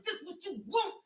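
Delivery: high, loud imitation of a menacing man; mock aggressive yell. Mimicking the guy who wanted to injure her, mock aggression. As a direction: throw it out like a mimicked threat. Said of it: "he sounded like he wanted to injure me".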